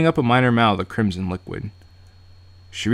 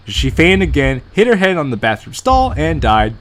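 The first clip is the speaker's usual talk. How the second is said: loud joke narration; 6 semitones higher, 6 dB louder than usual. Pretend. Jokes a fake ending loudly.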